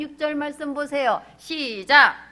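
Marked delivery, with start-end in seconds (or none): 1.4-2.3 loud cue to start reading; preacher's loud start cue; calling out. Real, not acted. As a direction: call the room in together. Calls congregation to read aloud.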